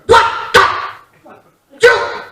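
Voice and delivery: loud, high sputtering rage; angry gibberish. Retold or imitated. Furious dad spluttering at sons' golf club fight. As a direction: splutter half-words in fury. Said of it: "angry gibberish".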